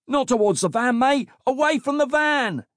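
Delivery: high-pitched urgent shout. A narrator's reading. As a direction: yell to steer them away. Running officer shouts warnings at kids.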